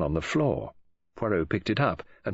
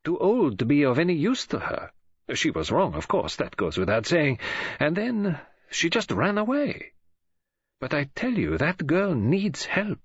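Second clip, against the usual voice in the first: lightly voiced, higher-pitched put-out detective; indignant elderly detective; 9 semitones higher than usual. Detective complains to his friend about the girl, indignant.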